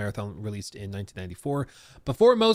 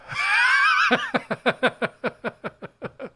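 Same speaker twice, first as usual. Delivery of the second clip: shrieking laugh; 3 dB louder than usual. Real, not acted. Shrieks over his nosebleed.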